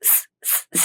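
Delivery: voiceless hissing like a snake; long airy snake hiss. Shown as a demonstration. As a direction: hiss through the teeth like a cobra. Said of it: "ഇങ്ങനെ സ്".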